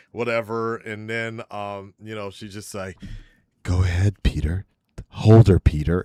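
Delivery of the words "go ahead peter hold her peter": loud, lower mock cheer at the screen. Teasing the movie couple, a loud mock cheer. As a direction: egg him on loudly, like a fan urging a kiss. Imitating the cheering friend in the theater.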